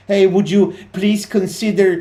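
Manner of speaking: high, loud, put-on overly polite voice; sarcastically sweet politeness, a sing-song mockery of niceness. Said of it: "They don't talk".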